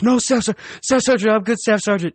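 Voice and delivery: very high, pleading, near breaking into a laugh; desperate squeaky begging. Retold or imitated. Recruit begs staff sergeant not to call the corpsman, very high desperate pleading. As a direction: beg for mercy in a squeaky panic.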